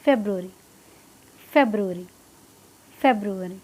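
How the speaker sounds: February fast as one word, pitch dropping; low, quick, falling February; quick run-through. Shown as a demonstration. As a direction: run the syllables of February together. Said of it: "बिल्कुल जल्दी से बोल के निकल जाना है".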